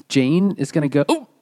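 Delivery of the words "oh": hiccups. Real, not acted. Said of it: "I have the hiccups now".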